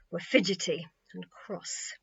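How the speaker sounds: plain reading voice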